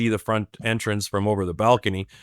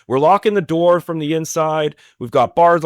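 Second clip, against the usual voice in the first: raised-pitch mock rant; retold telling-off; 6 semitones higher than usual. Will tees off on the group, higher retold rant. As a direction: list the grievances, pitched up and insistent. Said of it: "he tees off on them".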